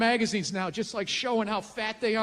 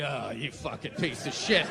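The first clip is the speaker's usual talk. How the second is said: much lower, sneering self-contempt; disgusted mirror voice; 12 semitones lower, 4 dB quieter than usual. Retold or imitated. Hungover man at the mirror, low sneering disgust. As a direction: growl at your own reflection.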